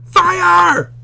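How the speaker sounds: sudden high shouted fire; teacher hamming up a scream in class; mock scream. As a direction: belt it out like a panicked alarm.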